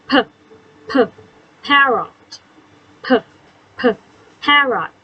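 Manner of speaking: p p popped before parrot; teacher sounding out the p sound; phonics sound. Shown as a demonstration. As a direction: pop the p sound, then say parrot. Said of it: "เสียงอะไรนะ".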